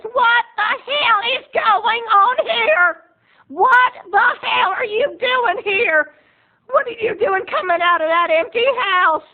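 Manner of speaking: very high, loud, slow outraged yell; hammed-up indignant shriek; mock furious aunt. Roberta catching them coming out of the house, outraged.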